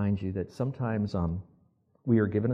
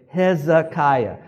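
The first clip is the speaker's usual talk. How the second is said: "Hezekiah" slow, syllable by syllable, higher; teaching pronunciation; 4 semitones higher, 6 dB louder, much slower than usual. Shown as a demonstration. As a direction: lead the room through "Hezekiah", drawn out. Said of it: "That's, again".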